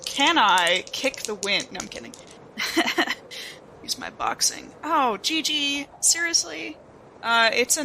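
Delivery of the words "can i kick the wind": normal talking voice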